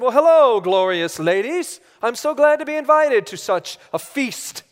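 loud, grand mock-royal greeting; dad hamming up a king, booming; swooping, theatrical royal proclamation; pompous king. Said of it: "kings don't talk like that".

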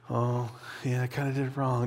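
soft, offhand admission; half-hearted shrug. Acted. Imagined Corinthians brushing off their sin, half-hearted shrug. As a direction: toss it off quietly, barely bothered. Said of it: "They weren't just like".

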